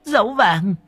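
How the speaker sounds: high, tearful, stuttering account; sobbing confession. A narrator's reading. Woman telling what the children plotted, sobbing confession. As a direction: confess through sobs, catching on your words. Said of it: "Trong cơn nức nở, mụ Vi kể lại".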